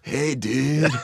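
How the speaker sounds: lightly voiced laid-back surfer dude. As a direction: toss off a casual surfer greeting. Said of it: "what did you sound like?".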